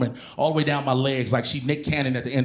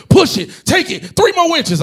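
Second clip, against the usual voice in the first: spotter coaching; 12 semitones higher, 7 dB louder than usual. Retold or imitated. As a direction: push him through the last reps.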